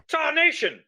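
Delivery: put-on startled old-timer cry; surprised exclamation. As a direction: blurt it out in alarm. Bear breaks the door.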